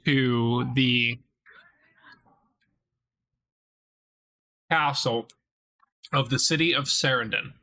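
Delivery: slow, even scene-setting narration; game master narration. A narrator's reading. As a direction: paint the scene slowly for the players.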